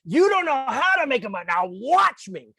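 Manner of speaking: exasperated scolding. Retold or imitated. Upset boss scolding the assistant who can't make a margarita, exasperated. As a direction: scold them for not knowing. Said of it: "she was really upset".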